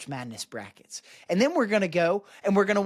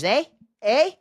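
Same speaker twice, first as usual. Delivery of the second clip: mock Canadian eh; 6 semitones higher, 6 dB louder than usual. Taunting Canadians with their "eh.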